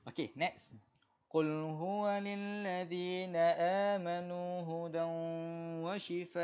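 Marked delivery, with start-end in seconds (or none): 1.3-6.4 Quran recitation. Shown as a demonstration. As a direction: recite for students to follow. Teacher recites Quran with tajwid.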